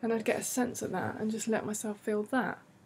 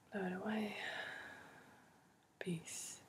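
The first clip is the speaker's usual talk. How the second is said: much softer whispered guidance; 14 dB quieter than usual. Acted. Guide whispers listeners toward a happy memory.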